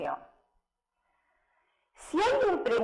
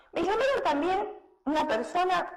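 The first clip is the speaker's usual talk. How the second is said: usual speaking voice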